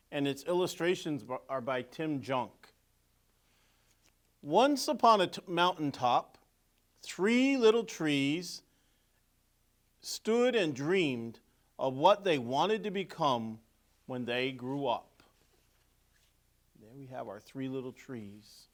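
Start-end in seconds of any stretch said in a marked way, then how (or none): 4.4-15.2 lively storybook narration; reading to children. A narrator's reading. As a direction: read it like a picture book to kids. Reading picture book aloud.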